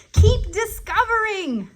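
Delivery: slow, high, loud slogan call-out; loud catchphrase. Acted. Host rallies young viewers with the show's motto, loud. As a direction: cheer the motto out slowly. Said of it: "That's why we say".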